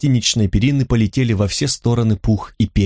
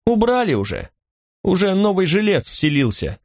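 high-pitched innkeeper's reply; brisk innkeeper; 5 semitones higher than usual. A narrator's reading. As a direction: answer as the innkeeper, pitch raised, settling at the end. Innkeeper brushes off the guest's questions. (хозяин гостиницы, высоким голосом)

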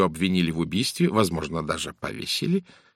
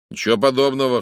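louder, higher outburst of denial; indignant cry; 5 semitones higher, 6 dB louder than usual. A narrator's reading. Actor cries out against Poirot's accusation, indignant cry. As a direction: cry out in protest. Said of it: "вскричал Брайан Мартин".

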